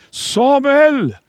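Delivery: high, loud call across the dark; a ringing divine call, high and loud. Acted. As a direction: call his name from far off. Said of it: "någon ropar hans namn".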